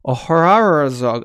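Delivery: Oharazog stumbled through slowly and loudly; attempted pronunciation. Real, not acted. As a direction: draw out Oharazog as an unsure guess at the name. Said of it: "I can't pronounce that".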